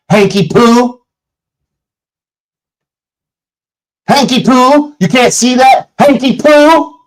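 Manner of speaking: high teasing nickname call; taunting. Acted. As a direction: needle him like a schoolyard bully.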